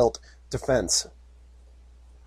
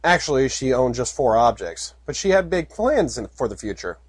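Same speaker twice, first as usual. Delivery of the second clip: ordinary talk, nothing marked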